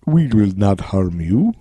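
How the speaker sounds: slow, drawn-out African-dialect alien voice; African accent. Acted. Alien reassures abductee in his head, slow African accent. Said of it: "like, this African dialect".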